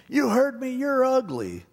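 high-pitched insulting parrot. Retold or imitated. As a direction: taunt it high, like a talking bird. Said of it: "He goes".

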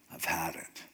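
low, very soft realization; hushed resolve. Acted. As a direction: say it under the breath, pitch dropped. Samson inwardly reaches his limit.

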